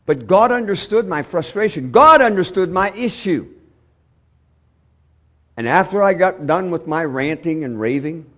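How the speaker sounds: loud, high, driving sermon delivery; impassioned preaching. Acted. Preacher telling the congregation how he raged at God, full-force preaching.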